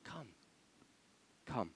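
soft, coaxing invitation; gentle appeal. Acted. Envoy appealing to the people, gently coaxing. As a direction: beckon them quietly. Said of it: "It's appeal".

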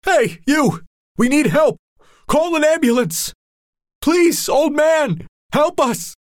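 very high, loud pleading; dying man shouting at the old man; desperate shout. Acted. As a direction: yell for help. Wounded man shouts for ambulance.

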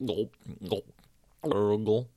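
spoken gulp gulp, then a gurgle. A narrator's reading. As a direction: mimic swallowing water. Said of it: "were the sounds that came from Izod".

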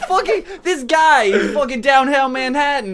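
loud, high, hammed-up New York tough talk; brash New York accent, breaking into laughs; New York accent demo. Shown as a demonstration. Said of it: "the next one ... is New York".

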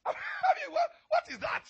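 high, loud laughing outburst; laughing shout. Real, not acted. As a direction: burst out laughing at your own joke. Pastor laughs at his own joke.

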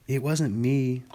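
mildly insistent denial; a touch of indignant insistence. A narrator's reading. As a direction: protest your innocence, lightly voiced. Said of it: "Piglet insisted".

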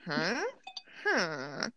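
low, dropping villager huh; Minecraft villager. Retold or imitated. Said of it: "the villagers go like".

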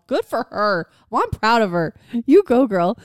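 high, loud burst of support; loud, proud cheerleading; cheering someone on. Retold or imitated.

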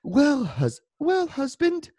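high, slow put-on accent, drawn out; high fake posh accent for his wife's reply. Husband to listener, high slow fake accent. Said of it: "I don't know why she has an accent".

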